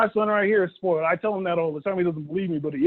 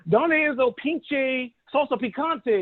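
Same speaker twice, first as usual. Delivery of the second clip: clumsy slow Spanish; 4 semitones higher, much slower than usual. Retold or imitated. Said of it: "It's like".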